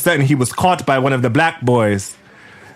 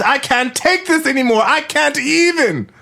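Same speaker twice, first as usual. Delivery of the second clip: dramatic exasperated wail; 9 semitones higher than usual. Acted. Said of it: "yelled out".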